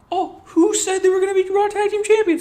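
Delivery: very high, loud mocking voice; mocking hoot. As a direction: hoot the whos like an owl. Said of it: "New Day was like".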